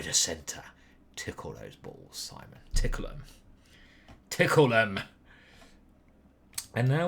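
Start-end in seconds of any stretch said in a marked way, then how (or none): 0.0-3.2 very low, much softer murmur; low muttered aside; growled aside. Real, not acted. 4.3-5.0 said louder and firm; louder repeat of Tickle 'em; punchy repeat. Real, not acted.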